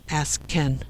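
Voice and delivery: ordinary delivery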